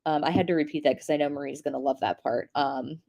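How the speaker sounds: ordinary talk, nothing marked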